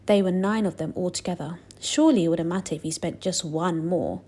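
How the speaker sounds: plain reading voice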